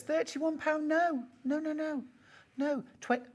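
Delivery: can't believe the price dropped; squeaky, high astonished protest; shocked disbelief. Real, not acted.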